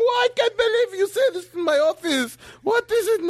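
high, mock-horrified cry; shocked doctor. Doctor aghast at a patient's confession, high mock horror. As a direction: play the doctor aghast in his office. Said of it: "the doctor ... he's shocked".

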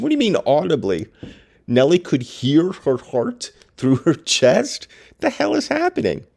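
high, disbelieving questions; can't believe what the book says; incredulous. Real, not acted. Reader baffled by an audible heartbeat, high incredulous questions.

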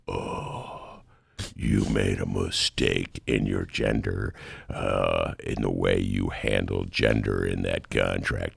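Evil Ed scolding the new mayor, deep and menacing; sinister villain. Retold or imitated. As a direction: chastise her like a creepy cartoon villain. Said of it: "Evil Ed stood up and tried to chastise Lori".

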